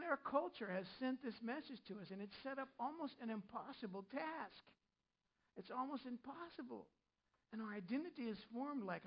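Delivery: normal talking voice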